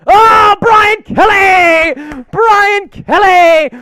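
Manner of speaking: slow, loud, high callout of the name; excited shout. Real, not acted. Thanking a viewer for a super chat, shouted slowly. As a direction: greet him like a big arrival.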